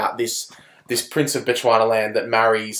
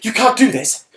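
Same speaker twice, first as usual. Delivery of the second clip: higher, louder, indignant pleading; mock the actor's overwrought exasperation; exasperated Brit; 8 semitones higher, 7 dB louder, faster than usual. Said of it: "He's exasperated in everything he says".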